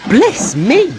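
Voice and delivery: high, loud startled cry; surprised exclamation. Acted. Fat Controller startled by Gordon's hissing, surprised. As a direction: burst out in surprise. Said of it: "said the Fat Controller".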